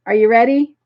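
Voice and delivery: normal talking voice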